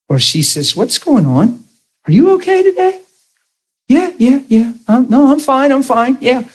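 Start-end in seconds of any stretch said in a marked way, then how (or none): 0.7-3.0 high concerned wife voice; worried wife. Acted. Wife on the phone asking her husband if he's okay, high and worried. 3.9-6.5 nervous denial. Acted. Husband brushing off the wife.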